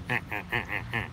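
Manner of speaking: low, soft duck laugh; evenly pulsed duck cackle; laughing duck call. Retold or imitated. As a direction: imitate a duck's laughing quack in short, even bursts. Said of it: "it sounds like a laugh".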